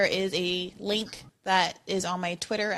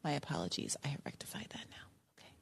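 whispered aside; 6 semitones lower, 19 dB quieter, faster than usual. Real, not acted. Apology aside after reading.

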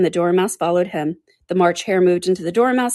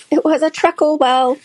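high, loud little creature voice; high-pitched put-on animal voice; dormouse voice; 10 semitones higher, 5 dB louder than usual. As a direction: pipe up as a small creature. Dormouse character voice.

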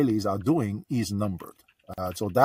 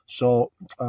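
normal talking voice

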